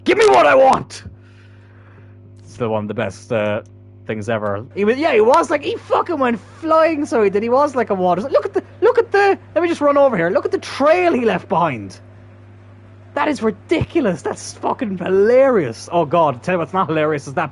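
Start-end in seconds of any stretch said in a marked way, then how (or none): 0.0-1.0 high, loud wrestler-style yell; Dave Bautista. Acted. Gamer quoting wrestlers for a joke, mock yell. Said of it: "Need Dave Bautista and Triple H". 4.7-15.9 high, squealing, tumbling amusement; laughing excitement. Real, not acted. Gamer retelling the player's slide, high laughing excitement. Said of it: "from laughing at that".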